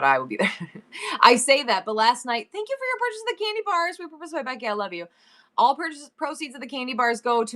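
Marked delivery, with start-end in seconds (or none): 2.5-5.1 canned thank-you. Retold or imitated. Mimicking a scripted thank-you message.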